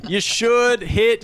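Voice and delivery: a lightly voiced old-fashioned dad; old-school hack comic. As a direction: play the proud old-timer. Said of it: "I remember in my day".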